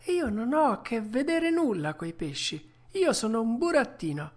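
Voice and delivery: put-on high young puppet voice; indignant puppet boy. Puppet corrects the tuna about what he is, high indignant voice. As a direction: pitch it up, without extra force.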